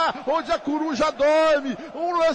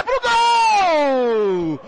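goal shout; 4 semitones higher, much slower than usual. Real, not acted. Goal call on radio.

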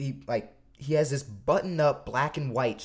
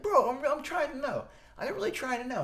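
insistent fan; 13 semitones higher than usual. Retold or imitated.

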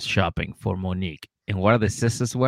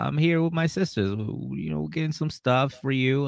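imitated boyfriend on phone; 6 semitones higher, 4 dB quieter than usual. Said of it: "He's like".

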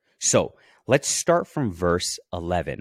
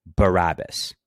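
mild shout, louder on the name; crowd's shout; 5 dB louder than usual. A narrator's reading. Crowd answering the governor, a mild shout read aloud. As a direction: call it back like a crowd, with restraint. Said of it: "The crowd shouted back".

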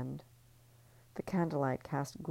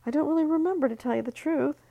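high, louder fake-casual lie; lying; 13 semitones higher, 5 dB louder, faster than usual. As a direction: fib airily in a raised voice. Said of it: "She lied".

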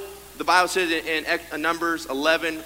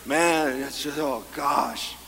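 slow, low, deflated sigh of a pessimist; gloomy pessimist; 3 semitones lower, slower than usual. Retold or imitated. Preacher mimicking a pessimist, slow and deflated. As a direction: droop it like a glass half empty. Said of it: "people that look at a glass half empty".

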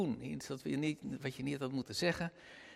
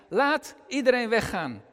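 a raised call, higher and louder; 7 semitones higher, 9 dB louder than usual. Acted. Joseph sends everyone out of the room, calling out. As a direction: call out the order for everyone to leave. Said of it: "riep hij".

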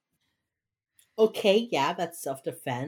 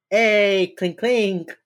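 mock toast cheer; 4 semitones higher, 8 dB louder, much slower than usual. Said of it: "mimicking a toast".

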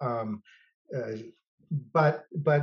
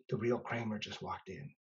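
hushed; 5 semitones lower, 10 dB quieter than usual. Retold or imitated. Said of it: "He said".

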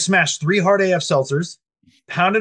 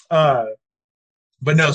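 ordinary talk

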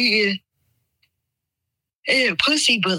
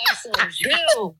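excited shout; slower than usual. Real, not acted. Repeats louder, hyped.

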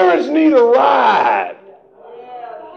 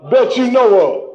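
ordinary talk

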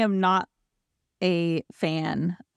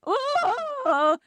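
mock whimper. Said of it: "it's just like".

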